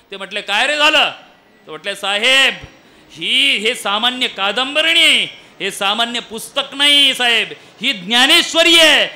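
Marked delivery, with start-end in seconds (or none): 0.4-1.1 loud, higher, sharp question; startled demand. Acted. Master answers servant's shout. 2.0-9.0 loud, emphatic protest, building higher; impassioned rebuke. Acted. Servant rebukes master over scripture.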